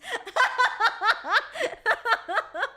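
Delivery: high, bursting laugh at her own joke; real laugh. Real, not acted.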